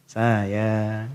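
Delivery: sweet-talking husband. Acted. Husband teases his sulking wife tenderly. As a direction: coax her out of the sulk.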